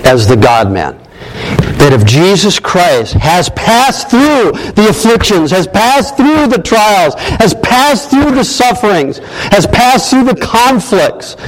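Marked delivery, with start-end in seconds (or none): 1.8-11.4 high, insistent pulpit build; rising preaching cadence. Real, not acted. Preacher assuring the congregation of Christ's victory, rising preaching cadence. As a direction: preach it in repeated waves, climbing higher.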